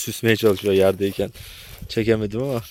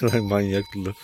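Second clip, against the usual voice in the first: ordinary delivery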